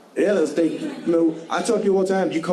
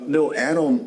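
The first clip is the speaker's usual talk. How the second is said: ordinary talk, nothing marked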